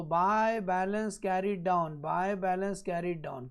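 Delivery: very slow, drawn-out words; slow dictation. Real, not acted. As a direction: dictate it slowly for note-takers. Teacher dictates the entry while writing. (धीरे-धीरे लिखवाते हुए)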